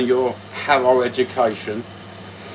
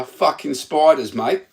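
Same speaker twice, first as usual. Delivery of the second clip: put-on Australian accent; 4 semitones higher than usual. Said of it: "That's what they say in Australia".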